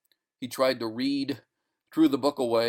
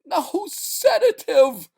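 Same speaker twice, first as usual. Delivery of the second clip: very high, loud scream; 10 dB louder, slower than usual. Acted. Delirious man refusing rest, scream. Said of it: "screamed Sale".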